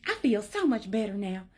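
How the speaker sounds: imitated self-satisfied composure; relieved, composed mother. Mother straightening up after the punch, mock composed relief. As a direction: play the mom who just got it out of her system.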